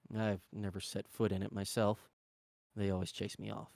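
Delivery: low, quiet character voice; reserved companion. Acted. Companion admitting he gets chased off, reserved. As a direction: answer low and understated.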